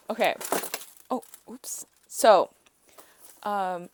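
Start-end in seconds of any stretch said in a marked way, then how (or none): none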